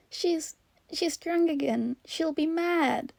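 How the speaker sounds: put-on high, slow frightened child; hissed fear. A narrator's reading. Child warning host about drunk adult, frightened. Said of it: "he hissed".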